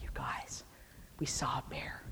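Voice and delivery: hushed, breathy telling of big news; whisper. Retold or imitated. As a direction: lean in and whisper the secret. Telling the group about the bear.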